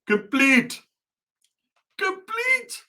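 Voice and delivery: very high, put-on button voice; dashboard announcement. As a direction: pipe up like a talking button. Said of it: "a button on my dashboard that says".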